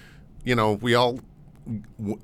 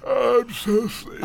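mock sleepy. Jokes about being sleepy.

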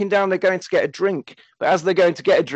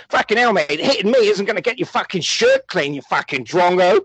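exasperated mock-Australian, pitched up; London impression landing in broad Australian; Gary's Australian-sounding accent; 5 semitones higher than usual. Gary refusing the punk's threat, Australian-sounding accent. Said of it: "your accent makes him sound Australian".

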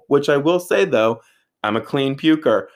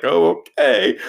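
humoring okay; 8 semitones higher, 6 dB louder, slower than usual. Retold or imitated. Brittany humoring drunk him.

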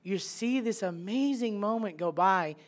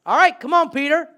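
high, loud welcoming call; loud friendly call to Peter; inviting call; 9 semitones higher, 12 dB louder, faster than usual. Acted. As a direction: wave him over with your voice. Jesus voiced inviting Peter.

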